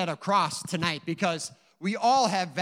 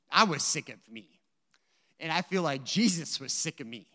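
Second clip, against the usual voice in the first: normal talking voice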